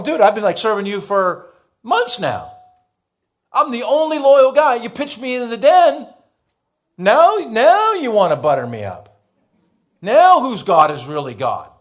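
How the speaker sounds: high, loud, hammed-up complaining; a loyal servant scolding the king who betrayed him; mock indignant rant.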